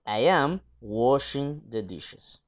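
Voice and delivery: slow, careful model sentence; slow model answer. Shown as a demonstration. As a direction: spell out the answer slowly for students. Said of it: "teacher reads the right answer". (respuesta modelo lenta y clara)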